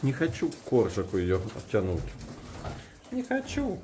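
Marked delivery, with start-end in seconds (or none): 3.1-3.8 very high, soft squeaky answer; squeaky pig voice. Pretend.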